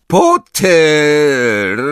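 loud, echoing teacher's shout; booming shout. A narrator's reading. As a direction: bellow the name across the hall. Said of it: "Głos potoczył się echem".

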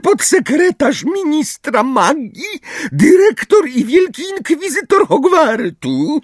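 high, squeaky official voice; piskliwa Umbridge. A narrator's reading. Umbridge announces her title to the centaurs, squeaky. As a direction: squeal the title self-importantly. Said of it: "jeszcze bardziej piskliwym głosem".

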